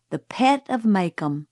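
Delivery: usual speaking voice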